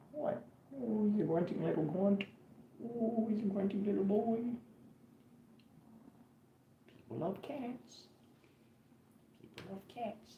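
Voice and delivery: high, soft cooing pet voice; cat baby talk. Acted. Owner cooing to his cat, high and soft. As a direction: coo at the kitty like a baby.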